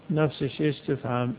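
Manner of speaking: ordinary delivery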